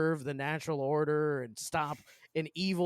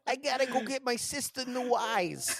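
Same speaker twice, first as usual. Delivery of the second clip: high, laughing Brooklyn dub voice; Brooklyn accent; 8 semitones higher than usual. Retold or imitated. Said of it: "he's got an awful accent".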